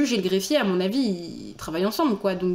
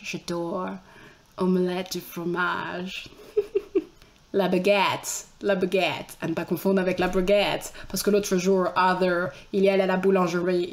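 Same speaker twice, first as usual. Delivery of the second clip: put-on low, slow American-accented French; 3 semitones lower than usual. Voicing Arthur the American in love with Mathilde, American accent. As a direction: play an American mangling French, drawn out. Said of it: "on va lui faire un accent américain".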